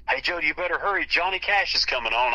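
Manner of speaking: put-on urgent call to hurry a child; mom calling out. Mom hurrying her child to hear [name], calling out. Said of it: "my mom goes".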